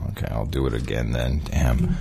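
usual speaking voice

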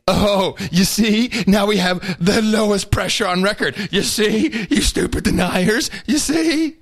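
high, condescending know-it-all; mocking scientists. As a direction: talk down to the stupid deniers. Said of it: "the science people are saying".

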